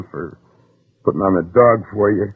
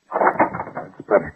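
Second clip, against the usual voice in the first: quiet menace; 5 semitones lower, 5 dB quieter, much slower than usual. Acted. Gunman after door locked.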